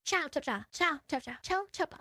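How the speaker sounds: soft, choppy little bursts; very short breaths. Retold or imitated. Reactor mimicking the speaker's short breaths, choppy and soft. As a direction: imitate short-breathed speech, quietly. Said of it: "very short breaths".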